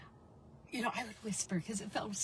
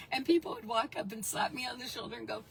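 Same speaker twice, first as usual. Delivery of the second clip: usual speaking voice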